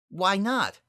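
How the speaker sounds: high, loud and sharp challenge; sharp-edged. Acted. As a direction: throw the question back with a cutting edge. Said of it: "a sharp edge in her voice".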